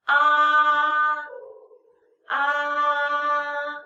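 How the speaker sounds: "Ah" held long, high and level; first tone. Shown as a demonstration. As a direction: sustain "ah" on one high, flat note. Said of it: "it's the first tone".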